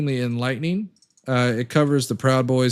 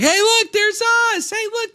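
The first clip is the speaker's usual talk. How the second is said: high mocking voice, much higher; mocking Proud Boys; 5 dB louder than usual. Streamer mocks Proud Boys watching themselves on TV, high mocking voice. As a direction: mimic them gawking at the TV, high and silly. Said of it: "And they're like".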